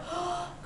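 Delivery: quick, soft acted gasp; little girl's shocked intake of breath; startled gasp. A narrator's reading. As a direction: suck in a breath in surprise. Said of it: "she gasped".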